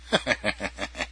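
a short soft mocking chuckle; laugh on cue. Podcast host laughs on demand for his listener, soft mocking chuckle. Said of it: "Make me fucking laugh".

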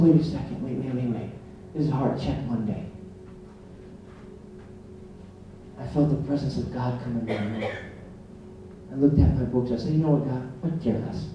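hushed retold prayer. Retells private prayer moment.

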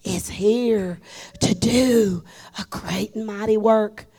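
slow, loud preacher proclamation; imitating Brother Norval. Preacher quoting Brother Norval's slow, loud proclamation. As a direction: draw out the Holy Ghost like a pulpit announcement. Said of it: "Brother Norval walks out and he says".

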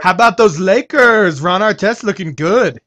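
put-on SoCal Laker fan accent; laid-back Southern California accent; Californian accent. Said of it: "That was my Californian accent".

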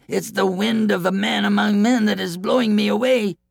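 high-pitched coward's cry. A narrator's reading. Coward makes excuses to the giant, high-pitched cry. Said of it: "cried the coward".

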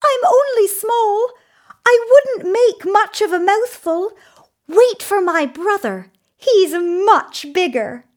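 high-pitched little goat pleading; small creature talking its way out. A narrator's reading. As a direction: bargain in a tiny, high goat voice. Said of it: "said the little Billy Goat Gruff".

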